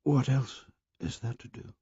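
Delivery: soft, hushed whisper. A narrator's reading. Simon whispering his answer to Piggy before the assembly. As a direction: whisper it, shrinking from the circle. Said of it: "Simon whispered his answer".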